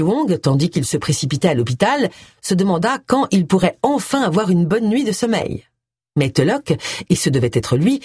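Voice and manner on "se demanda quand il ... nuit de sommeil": ordinary delivery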